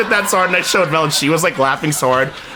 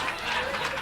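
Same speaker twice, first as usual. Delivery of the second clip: sharp mock gasp of realization; imitated shocked gasp; 14 dB quieter than usual. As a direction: gasp as if it just dawned on you. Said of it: "And then she realizes".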